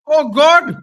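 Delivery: high, loud shocked cry. Real, not acted. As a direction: cry out as the ball flies near. Fan reacts to near goal.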